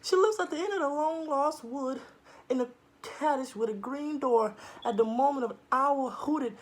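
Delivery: put-on nervous girl.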